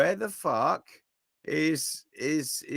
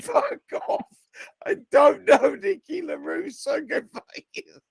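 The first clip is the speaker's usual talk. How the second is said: high-pitched mock outrage; hammed-up fed-up protest; retold exasperation; 10 semitones higher, 3 dB louder than usual. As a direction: act out snapping under badgering, amused. Retold angry answer to badgering.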